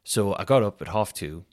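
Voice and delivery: normal talking voice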